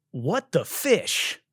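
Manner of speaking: mocking a too-clever restaurant name; mock pun name. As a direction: sneer the pun out slowly. Mocking punny restaurant names.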